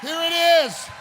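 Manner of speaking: loud, slow, ringing sermon voice; fiery preacher calling out; full-force preaching. Acted. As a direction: preach it out to the whole church.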